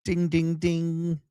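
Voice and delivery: high, sing-song bell imitation; mock game-show ding. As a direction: ring it out like a quiz bell. Mock quiz-show bell call.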